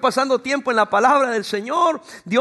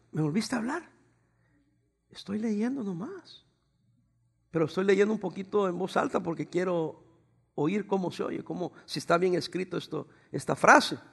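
softer, slowed voice for the wife's reply; soft imitated wife; 3 semitones lower, 7 dB quieter than usual. Pastor voicing his wife's soft reply while she reads. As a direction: answer gently, mid-reading.

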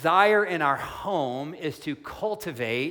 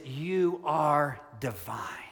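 softer, slow, hushed declaration; reverent confession; 4 dB quieter, much slower than usual. Retold or imitated. Pastor voicing Peter's confession to Jesus, hushed and slow. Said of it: "What he is saying is".

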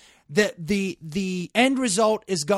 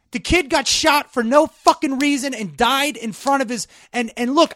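angry; 6 semitones higher, 3 dB louder than usual. Real, not acted. Heated rant continues.

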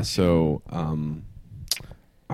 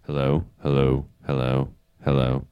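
ordinary talk, nothing marked

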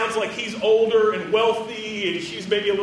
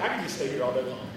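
lazy contentment; 9 semitones lower, 7 dB quieter, faster than usual. Retold or imitated.

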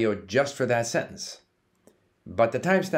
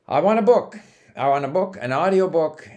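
raised, emphatic mock request; pretend voice command; 6 semitones higher, 5 dB louder than usual. Learner telling the computer what material to find, emphatic pretend command. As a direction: pitch it up like ordering from a machine.

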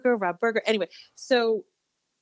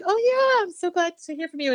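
gushing; 10 semitones higher, 3 dB louder, faster than usual. Retold or imitated. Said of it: "I was like".

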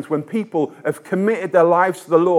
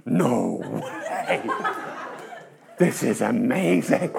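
laughing amazement; 5 semitones lower, 3 dB quieter, much slower than usual. Retold or imitated. Amazed reply to Martin's story.

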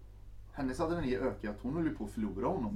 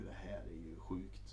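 soft, slow low murmur; hushed realisation; 6 semitones lower, 12 dB quieter than usual. Retold or imitated. He realises the danger, said softly and slowly. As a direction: let it sink in quietly. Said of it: "han liksom bara insåg ju".

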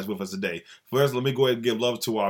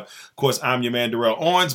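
normal talking voice

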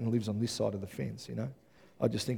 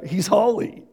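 higher, louder, rising on holy; fervent preaching; 8 semitones higher, 6 dB louder than usual. Real, not acted. Pastor exclaiming God's holiness to the church, fervent. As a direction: lift it up in wonder.

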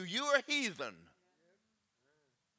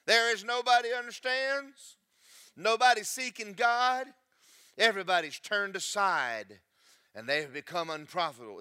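preaching scripture; 3 semitones higher, 5 dB louder than usual. Acted. Loud preached scripture reading.

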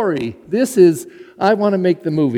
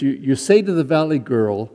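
ordinary talk, nothing marked

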